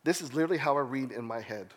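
ordinary delivery

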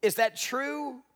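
normal talking voice

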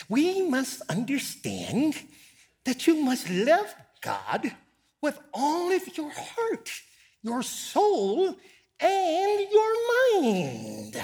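high, squeaky, slow, drawn-out preacher's voice; James Cone's squeaky voice. Retold or imitated. Preacher imitates a theologian quoting scripture, high and squeaky. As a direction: squeeze it up into a thin, irritating squeak and stretch it. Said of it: "he had this real irritating, squeaky voice".

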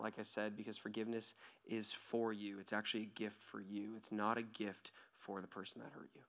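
ordinary talk, nothing marked

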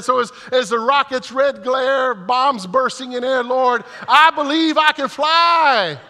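high, loud hammed-up praying; high, loud comic prayer; mock fervent prayer. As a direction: pray like he's trying to outdo her. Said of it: "and I said".

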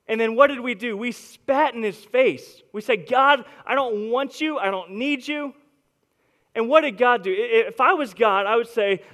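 loud, driving preacher's voice; full-force preaching. Acted. Pastor preaching to his congregation, loud and driving.

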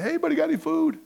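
high-pitched acted call to a crowd. Imagined disciple searching the crowd.

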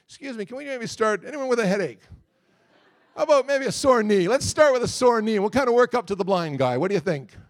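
mock hedging. Preacher imagining himself facing a blind man, mock hedging. Imagined nervous stalling before a crowd.

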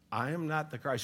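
a quiet, unsure mumble; sheepish mumble. Retold or imitated. Staff echoing the teacher at the retreat, unsure quiet mumble. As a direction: say it back hesitantly, not yet convinced. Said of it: "And we're like".

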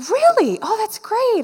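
high-pitched, imitated delighted reaction; delighted surprise, imitated. Woman reacting to the speaker's answer at lunch, delighted surprise. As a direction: act out her pleasantly amazed "oh!", pitch leaping up.

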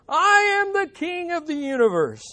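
very high, loud proclamation; deluded king at full pitch; defiant madman's shout. Acted. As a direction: shout over the doctor, undeterred. Madman defies the doctor, shouting.

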